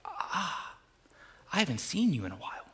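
soft, breathy-quiet, almost whispered; hushed inner voice. Retold or imitated. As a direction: say it under your breath, moved. Wife's feeling imagined, hushed.

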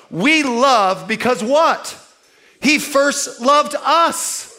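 slow, rising call to the crowd; slow answer. Acted. Preacher prompting the church to answer, slowly.

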